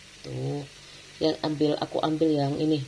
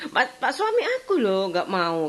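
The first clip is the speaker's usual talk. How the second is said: mock complaint; 7 semitones higher than usual. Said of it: "kayak gitu loh".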